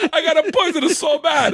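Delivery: high Italian impression through laughter; laughing, hammed-up Italian accent. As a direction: do the Italian husband while cracking up. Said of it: "Her husband's an Italian guy".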